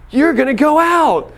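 sudden high, loud outburst of hurt anger; getting mad. Retold or imitated. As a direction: blurt it out, high and loud, as it dawns on you. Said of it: "getting so mad".

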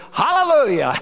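high ringing congregational reply; echoed hallelujah. Retold or imitated. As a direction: answer back with fervour. Congregation echoing the call.

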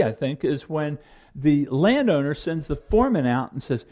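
usual speaking voice

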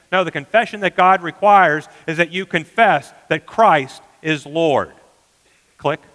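ordinary talk

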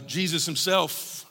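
normal talking voice

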